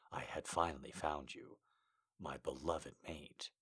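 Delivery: put-on low, soft storytelling boyfriend; hushed lover voice. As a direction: confide his past quietly. Male character continues story.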